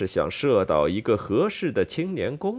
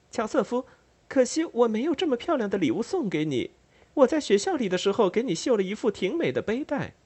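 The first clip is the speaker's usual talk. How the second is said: put-on high, quick young girl's voice; a sweet younger sister teasing her brother, much higher; much higher, fast, light girl's voice; sister's voice; 11 semitones higher, faster than usual. Sister Amelia speaks to brother.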